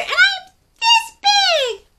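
very high, loud squeaky tiny dinosaur; a tiny creature proudly calling out; little creature. Acted. Reader voicing little scuttling dinosaur answering Pipkin. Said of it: "said one little creature".

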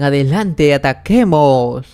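rallying call, last word stretched out; acted battle cry. Bodyguards urge each other to attack, acted battle cry. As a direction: call the charge with a long drawn finish. Guards charging in a story.

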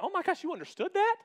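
high, astonished exclamation; amazed. Retold or imitated. Pastor marvelling that the barista understood the order. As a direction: shoot the voice way up in disbelief.

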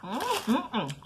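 talking with food in mouth; mumbling between bites; chewing while talking. Real, not acted. Seen 2: Bit the drumstick at -9; jaw and lips move while she speaks.

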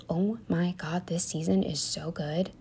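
hushed, thrilled whisper; breathless whispered gush; excited whisper. Real, not acted. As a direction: whisper it like a delicious secret. Excited about new TV season.

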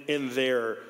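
usual speaking voice

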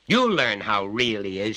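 loud threat; shouted after him. Acted. Father shouts after the departing boy, loud threat. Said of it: "shouted his father after him".

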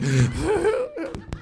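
short high-pitched cackle; laughing after your own punchline. Real, not acted. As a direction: break into a gleeful laugh.

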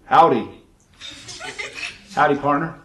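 lightly voiced American cowboy accent; mock American greeting. Kiwi imitating how Americans say hi, mock American accent. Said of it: "they just say".

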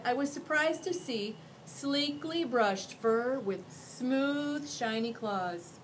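ordinary delivery, nothing marked

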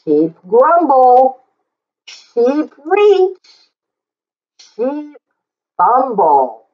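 plain reading voice

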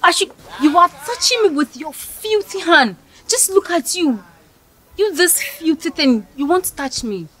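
high, scornful recoil; a proud girl recoiling from his filthy hand; disgusted outrage. Acted. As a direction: push him off with disgust.

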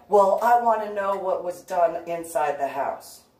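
loud demanding stranger; pushy stranger. Retold or imitated. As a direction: imitate the man pushing back.

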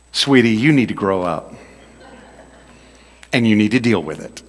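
quiet, patronizing; mild condescension. Shown as a demonstration. Preacher reveals the real meaning in a quiet, patronizing tone. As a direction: talk down sweetly. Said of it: "what it really means is".

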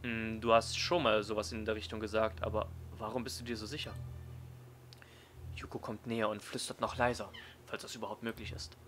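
ordinary talk

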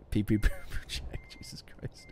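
slow breathy whisper under strain; concentrated whisper. Real, not acted. Streamer whispers to himself while reversing carefully. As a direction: whisper it through gritted focus.

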